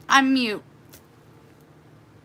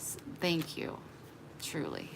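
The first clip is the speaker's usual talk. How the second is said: whispered heartfelt thanks; soft thanks; 15 dB quieter than usual. Real, not acted. Thanking a viewer, whispered. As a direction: breathe it out, barely voiced. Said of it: "quiet emotional thanks".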